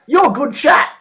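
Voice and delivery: bright mock compliment; quoting her. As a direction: chirp it like the impressed date. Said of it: "She goes".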